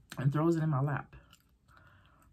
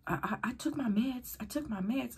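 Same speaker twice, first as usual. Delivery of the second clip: higher, stammering plea; high defensive insistence; 10 semitones higher, 3 dB quieter than usual. Retold or imitated. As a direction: insist nervously. Friend insisting on meds.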